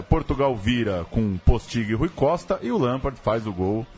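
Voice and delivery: ordinary talk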